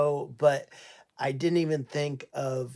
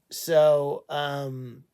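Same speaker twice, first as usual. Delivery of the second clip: usual speaking voice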